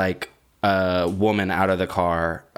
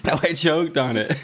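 laughing; 6 semitones higher than usual. Real, not acted. Laughing while recovering from choking.

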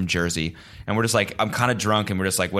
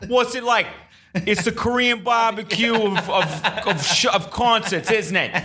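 much higher, slow and hammed-up Jersey accent; mock Jersey accent; 13 semitones higher, slower than usual. Imagining the dude delivering the joke, slow mock Jersey accent. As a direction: drag out the stolen punchline like a Jersey bro. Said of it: "in a fucking Jersey accent".

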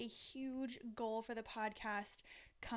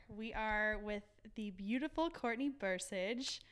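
radio voice; 5 dB louder than usual. Real, not acted. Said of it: "the sexy voice when you have the mic".

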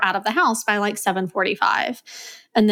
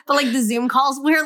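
usual speaking voice

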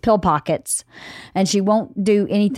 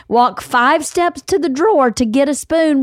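ordinary talk, nothing marked

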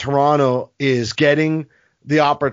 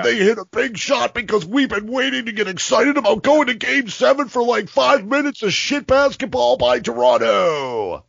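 high, gushing mock relief in a put-on voice; mock excited broadcaster; 9 semitones higher than usual. Host mocking the TV broadcaster's fake excitement, high gushing put-on voice. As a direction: lampoon the announcer finally getting his thrill. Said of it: "it's like".

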